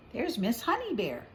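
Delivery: usual speaking voice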